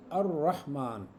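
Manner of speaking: अर्रहमान with no L and a doubled R; lam dropped, shadda. Shown as a demonstration. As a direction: drop the L and press the R in अर्रहमान. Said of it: "लाम को pronounce किए बगैर अगले को शद कर देंगे". (अर्रहमान, लाम छोड़कर र पर शद)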